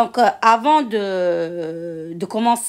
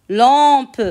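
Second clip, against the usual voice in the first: "Lampe" stretched and louder, lam-pe; syllable by syllable; 3 semitones higher, 5 dB louder than usual. Shown as a demonstration. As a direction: say "Lampe" long, sounding the final pe. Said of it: "Je découpe les mots de la fiche en syllabes". (« Lampe » découpé en syllabes, lam-pe)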